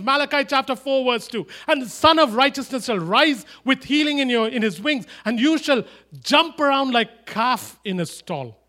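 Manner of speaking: loud, high prayer proclamation; preaching at full force. Acted. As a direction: call out to God with force.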